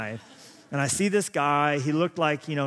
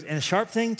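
put-on childlike voice set higher, eager rising question; an excited little boy wondering; imitating three-year-old son; 7 semitones higher than usual. Son quizzing dad about the knife, higher childlike eagerness. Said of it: "He goes".

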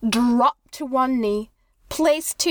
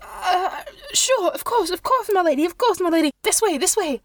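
higher, eager servile voice; nervous monk; 5 semitones higher than usual. Acted. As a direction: hurry to please the lady. Monk leads lady nervously.

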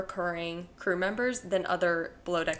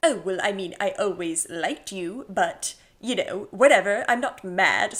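put-on a South African accent on Gary, laughing at the end; casual South African shrug; 4 dB louder than usual. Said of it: "that was so much better than mine".

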